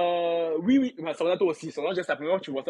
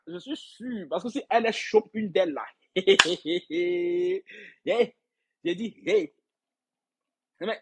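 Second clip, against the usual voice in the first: normal talking voice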